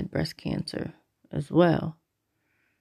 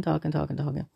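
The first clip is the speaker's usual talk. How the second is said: ordinary talk, nothing marked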